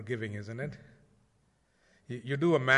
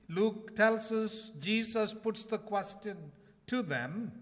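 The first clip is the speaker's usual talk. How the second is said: raised, ringing pulpit voice; urgent sermon delivery; fervent preaching; 4 semitones higher than usual. Acted. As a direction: preach it out to the congregation. Preaching at full force.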